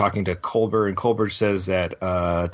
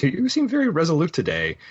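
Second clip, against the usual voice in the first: higher, faster mock voice; a raised voice for Georgiou, quickly; imitating Giorgio; 10 semitones higher, faster than usual. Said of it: "and he goes".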